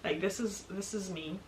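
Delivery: ordinary talk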